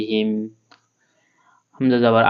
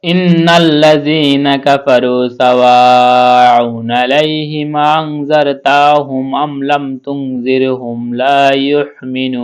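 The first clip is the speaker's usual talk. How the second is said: Quran recitation; 2 semitones higher, 6 dB louder, much slower than usual. Acted. Teacher reciting the whole verse for students, slow chant. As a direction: chant the verse slowly with held vowels.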